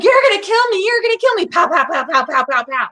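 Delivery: shrill hammed-up hysterics into gun noises; mock panic. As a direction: act out a panicked shooter, over the top. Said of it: "accurate reenactment of what happened".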